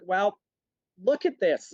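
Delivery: high, pushy complaining voice; entitled customer. Retold or imitated. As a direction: mimic a fussy rich diner demanding attention. Same customer voiced again.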